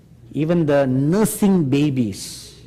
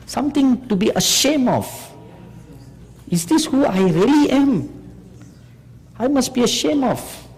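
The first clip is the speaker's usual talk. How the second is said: rising shame; 9 semitones higher than usual. Real, not acted. Preacher shaming the congregation.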